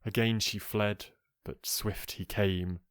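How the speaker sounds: ordinary delivery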